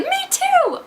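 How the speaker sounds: very high-pitched eager outburst; excited squeal. Acted. Reader voicing Frank's reply to the snake man, excited squeal. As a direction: pipe up in a sudden squeak of agreement.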